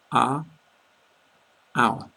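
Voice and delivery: are short, our longer and rounder; are versus our. Shown as a demonstration. Teacher asking learners to listen to the pair, clearly. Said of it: "a lot longer".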